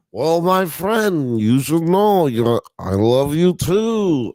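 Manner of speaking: lightly voiced, high, slow alien doctor; a weak stab at Zoidberg; a hint of the alien doctor voice; bad Zoidberg. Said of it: "That was a bad Zoidberg".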